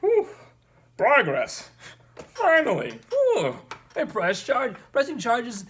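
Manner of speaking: high, loud mock celebration; retold elation. Retelling their reaction to one officer being charged, loud high-pitched elation. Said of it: "I was like".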